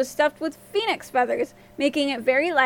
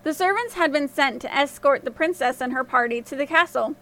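plain reading voice

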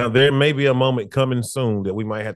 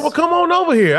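mock dare; 4 dB louder than usual. Friend's retold dare to fight.

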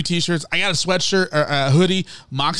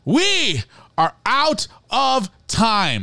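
drawn-out; 6 semitones higher, much slower than usual. Acted. Playful drawn-out sign-off.

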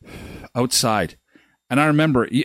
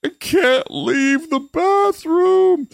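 high, strained, half-laughing plea; desperate wail; much slower than usual. Retold or imitated. To the family at the door, a high desperate half-laughing wail. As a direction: whimper it out like you're stuck on the toilet. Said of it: "And I'm like".

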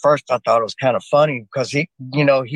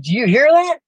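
raised, higher urgent question; urgent call; 9 semitones higher than usual. Retold or imitated. Soldier urgently asking his sergeant about the noise. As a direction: call out, uneasy and pressing.

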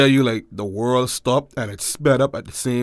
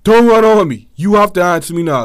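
louder, higher insistence; retold demand; 5 semitones higher, 6 dB louder than usual. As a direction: stop the father from leaving. Confronting father in retelling.

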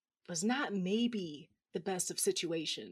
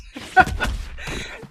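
sharp unvoiced gasp of pain; pained gasp laugh; 8 semitones higher, 11 dB louder than usual. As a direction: gasp as the punch lands. Punched in the face.

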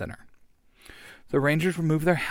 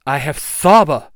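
proud yell; 8 dB louder than usual. Acted.

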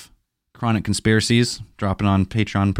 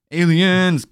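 excited cheer; 10 semitones higher, 3 dB louder, much slower than usual. Acted. Excited joking cheer about aliens.

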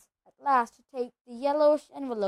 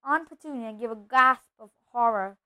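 ordinary delivery, nothing marked

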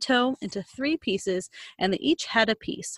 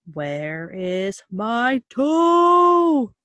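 slow moaning voice, growing louder; groaning ghost, louder; 3 semitones higher, 7 dB louder, much slower than usual. Acted. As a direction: moan it slowly and louder, coming closer. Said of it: "it groaned".

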